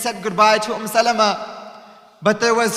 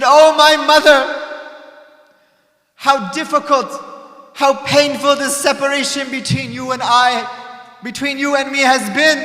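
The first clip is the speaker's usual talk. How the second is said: higher, much louder cry of grief; loud lament; 4 semitones higher, 5 dB louder than usual. Acted. Hussein lamenting at his mother's grave, loud grief.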